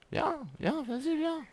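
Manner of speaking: voix très haute, moqueuse et provocante; provocation aiguë. Real, not acted. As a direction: narguer l'ennemi d'une voix perchée. Provoque un adversaire. (défie l'adversaire, ton railleur très aigu)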